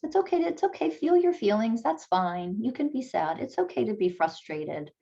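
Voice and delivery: put-on a parent calming a child who is frustrated; soothing a child. Shown as a demonstration. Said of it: "teaching your child from a very young age".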